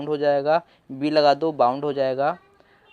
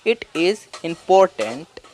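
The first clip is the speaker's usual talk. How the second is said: very slow, word by word; slow reading; much slower than usual. Shown as a demonstration. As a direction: read it out slowly and clearly. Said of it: "देखिए, पहले im". (धीरे-धीरे पढ़ना)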